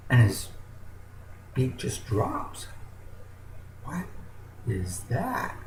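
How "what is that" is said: thinking to himself. Acted. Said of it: "he thinks to himself".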